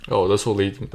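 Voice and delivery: normal talking voice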